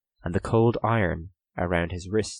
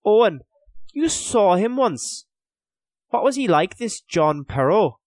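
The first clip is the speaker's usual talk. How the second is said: high, raised question; urgent questioning; 13 semitones higher, 4 dB louder than usual. A narrator's reading. Hugh seeks distraction from misery, urgent questioning. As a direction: press a companion for news. Hugh asks cellmate about captor.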